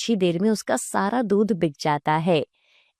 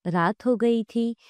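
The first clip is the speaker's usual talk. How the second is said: ordinary delivery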